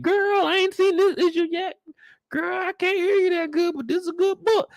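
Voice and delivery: high mock woman under the dryer; salon lady. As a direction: play a chatty lady under the hair dryer.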